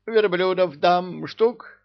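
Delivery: high, slow haggling; high haggling emir. Acted.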